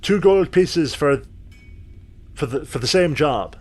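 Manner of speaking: dwarf who can't follow the offer; puzzled dwarven smith. Acted.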